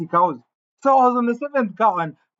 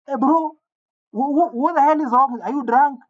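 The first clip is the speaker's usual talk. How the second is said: retold screaming; 6 semitones higher than usual. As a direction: shout for help, half panicked. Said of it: "I start screaming".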